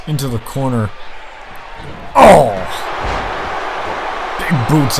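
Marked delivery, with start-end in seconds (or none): 2.1-2.5 shocked cry. Real, not acted. Reacting to impact.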